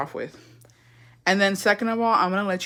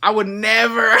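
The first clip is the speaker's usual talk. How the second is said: raised-pitch show of innocence; mock indignant denial; 4 semitones higher, 3 dB louder than usual. Daughter denies it to dad, mock indignant. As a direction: play offended innocence, a little hammed-up.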